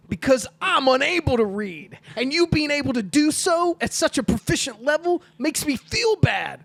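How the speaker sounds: loud, high, over-articulated outburst; mock yelling. Acting out an angry sketch character for friends, mock yelling. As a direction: yell the confession in hammed-up anguish. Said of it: "Why are you yelling at me?".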